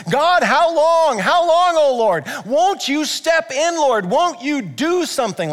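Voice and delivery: high, loud pleading cry; desperate prayer cried high and loud; crying out to God. Acted. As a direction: beg God to step in, drawn out. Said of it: "he's crying out, he's like".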